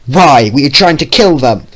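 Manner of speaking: loud angry shout. Acted. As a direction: yell it in fury. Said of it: "Vio shouted".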